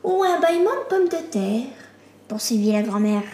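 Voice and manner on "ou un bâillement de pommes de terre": higher put-on grandmother voice. A narrator's reading. Grandmother joking at breakfast, high character voice. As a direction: play a grandmother musing in a high voice.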